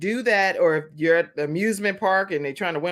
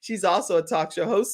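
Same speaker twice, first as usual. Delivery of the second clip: ordinary delivery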